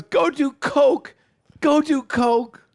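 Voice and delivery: drawn-out, slowed quote; slow mock insult. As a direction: spell it out slowly, mockingly. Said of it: "the guy goes".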